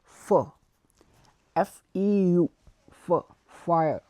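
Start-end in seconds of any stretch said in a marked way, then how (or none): 0.0-0.5 feu said fə, lowered; French feu. Shown as a demonstration. Teacher modelling for students, feu in French. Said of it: "we will say feu".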